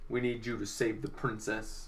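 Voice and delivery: low, soft plain plea; said softly, no panic; wrong quiet take. Acted. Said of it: "He didn't talk like that".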